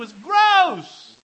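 high, loud burst of comic revulsion; disgusted shout. Acted. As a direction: blurt it out, hammed-up and disgusted. Repeats disgusted punchline.

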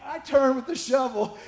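normal talking voice

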